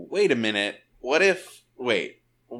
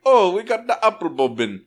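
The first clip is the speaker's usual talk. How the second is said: louder, far-off Jamaican parrot voice, upbeat ad read; mock Jamaican accent as a cartoon parrot pitching rum; 5 semitones higher, 6 dB louder than usual. Commercial parrot pitching rum to viewers, in a hammed-up Jamaican accent. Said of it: "a parrot who has a Jamaican accent".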